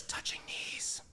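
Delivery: hushed mock scandal; scandalized whisper. Repeating chat's gossip, a hushed whisper.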